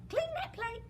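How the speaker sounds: high, sing-song "clean that plate"; high coaxing repeat. Acted. Servant girl repeats order.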